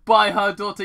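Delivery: loud laugh through the words. Real, not acted. Reader laughing at the story's mistake. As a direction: crack up mid-line.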